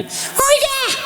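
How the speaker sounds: put-on high, small boy's answer; young schoolboy piping up; child's voice. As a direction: squeak it like a little kid. Boy called over by teacher.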